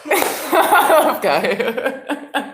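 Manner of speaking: I love Kaya drawn out slowly through laughter; laughing through it. Shown as a demonstration. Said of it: "same line, slow and laughing".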